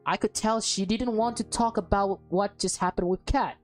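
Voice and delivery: normal talking voice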